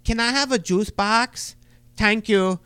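lightly voiced little kid asking for juice; sweet little kid voice, mildly done; four-year-old voice. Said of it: "how the four-year-olds just".

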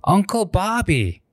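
put-on high, slow little kid voice; imitating a toddler. Toddler calling his uncle by name, high and slow. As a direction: say it like a small child naming you. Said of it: "he looks at me and he says".

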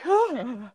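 high-pitched imitated gasp; acted high "ah" of awe; mock starstruck gasp. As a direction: gasp as if overwhelmed. Acts out starstruck reaction afterwards.

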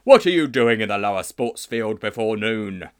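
loud booming demand; stern, very loud interrogation; yelling teacher. Acted. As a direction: bark the question across a sports field. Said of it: "yelled a voice like an elephant".

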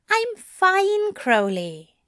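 high-pitched reassurance, dropping on the name; assuring. A narrator's reading. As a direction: insist you are unharmed. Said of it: "Aziraphale assured him".

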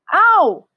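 loud, high-pitched "ow!"; pained yelp. A narrator's reading. Elephant bitten on the leg, pained yelp. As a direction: cry out as if bitten. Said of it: "said Trunky in his big, deep voice".

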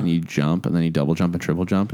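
ordinary talk, nothing marked

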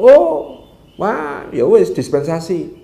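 high loud oh, then slow letting-off; startled, then waving him through slowly; recruiter's surprised exemption. Acted. (perekrut kaget lalu pelan memberi dispensasi)

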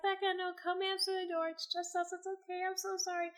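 soft, high-pitched pleading reassurance; retold apology. Prankster confessing to crying friend, apologetic reassurance. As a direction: gently talk a frightened friend down, voice small and high.

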